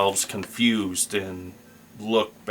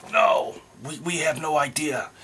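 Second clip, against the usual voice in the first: whispered goblin reply; 6 semitones higher than usual. Acted. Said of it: "The goblin ... that spoke in broken common said".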